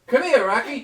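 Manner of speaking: loud, high call across the bar; hammed-up calling out to Rocky; calling the dog.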